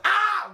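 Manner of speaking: high-pitched mock "ah"; exaggerated high squeal in imitation; imitated cry. Imitated woman after demanding a kiss.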